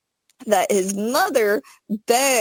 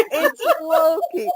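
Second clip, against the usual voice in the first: high voice cracking up with laughter; helpless giggly admission; laughing reveal; 7 semitones higher than usual. Real, not acted. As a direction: blurt the name through laughter. Both laughing as secret comes out.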